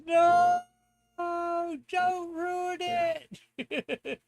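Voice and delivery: high, drawn-out pleading voice; whiny plea. Pretend. Reading a chat plea aloud.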